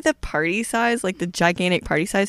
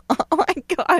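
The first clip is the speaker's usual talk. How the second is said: delighted gasp; 9 semitones higher than usual. Retold or imitated. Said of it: "I was like".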